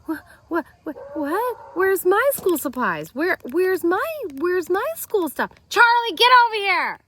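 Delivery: put-on high, loud panicked child voice; frantic kid. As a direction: panicky kid hunting for supplies. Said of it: "And I'm like".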